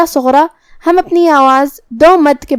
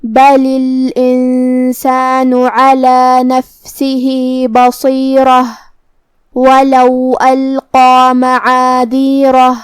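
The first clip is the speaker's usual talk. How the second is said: slow, melodic tajweed recitation; Quran recitation; much slower than usual. Shown as a demonstration. Teacher recites the full verse for students, slowly. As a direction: chant the verse slowly and evenly. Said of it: "recites the verse in tajweed".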